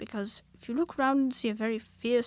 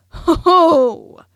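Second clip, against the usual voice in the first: very loud, high sudden Ho, ho!; 7 semitones higher, 18 dB louder than usual. A narrator's reading. Christopher Robin surprising Pooh and Piglet, loud sudden Ho, ho. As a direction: burst out to startle them. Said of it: "loudly and suddenly".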